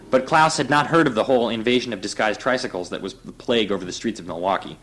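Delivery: ordinary delivery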